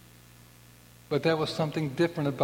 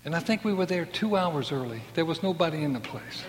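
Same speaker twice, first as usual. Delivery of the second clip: ordinary delivery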